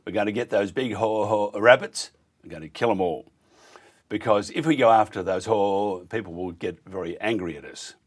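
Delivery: usual speaking voice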